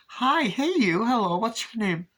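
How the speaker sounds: imitating a ditzy movie character waking up; dazed waking greeting. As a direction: chirp it out as if just woken and delighted.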